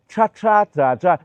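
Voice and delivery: tra with a rolled r; 卷舌发音. Shown as a demonstration. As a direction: curl the tongue on tra tra. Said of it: "要卷舌一下".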